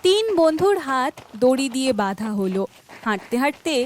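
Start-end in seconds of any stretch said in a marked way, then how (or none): none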